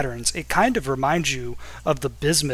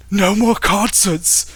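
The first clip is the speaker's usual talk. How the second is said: loud, high hammed-up wail; acted wailing; 7 semitones higher, 6 dB louder, much slower than usual. Fan falls to her knees, melodramatic wailing. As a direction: wail it slowly, like a heartbroken fan. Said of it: "falls to her knees wailing".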